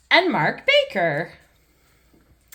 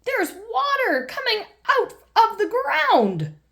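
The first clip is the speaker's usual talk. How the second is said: put-on high, excited little girl voice with a laugh; thrilled at the gushing water; excited Peppa; 13 semitones higher than usual. Peppa showing her family the water from the ground, high and excited. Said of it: "says Peppa".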